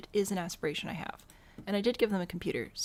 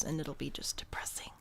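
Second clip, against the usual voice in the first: whisper; 3 semitones lower, 7 dB quieter than usual. Real, not acted. Joking about a sad room.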